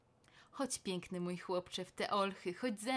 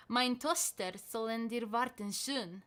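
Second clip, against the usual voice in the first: usual speaking voice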